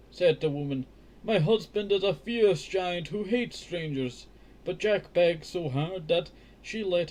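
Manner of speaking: slow, lifted read-aloud voice; careful children's book narration; storybook reading. A narrator's reading. Reads the castle scene.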